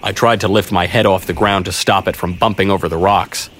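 plain reading voice

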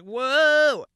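loud, startled whoa; a sudden loud gasp of shock. Retold or imitated. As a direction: react as if the painting just bled. Painting cries blood, shocked reaction.

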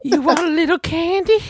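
put-on very high, slow, creepy old man voice with laughter; creepy old man impression. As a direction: imitate the Family Guy old man offering candy. The old guy that always whispered.

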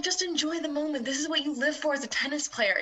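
lightly voiced encouraging coach; halftime pep speech. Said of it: "Can I get the Coach Tyler impression one more time".

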